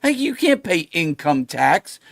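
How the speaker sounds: ordinary talk, nothing marked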